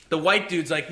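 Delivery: ordinary talk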